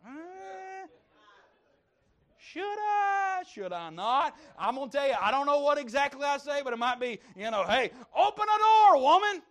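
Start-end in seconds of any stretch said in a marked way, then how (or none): 0.1-4.4 hesitant wife. Retold or imitated. Preacher voicing hesitant wife. 7.6-9.4 high, loud mock yell at a wife who won't open up; a husband joking that he'd holler at his wife to open the door, mock yell. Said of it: "I wouldn't say that out loud".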